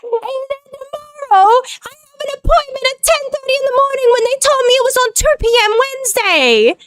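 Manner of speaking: high, strained exasperated whine; squeaky-high disbelief at the mix-up; exasperated venting. Real, not acted. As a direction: vent it high and thin, falling at the end. Venting about a muddled appointment.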